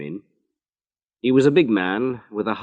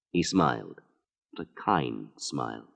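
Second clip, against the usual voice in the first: ordinary delivery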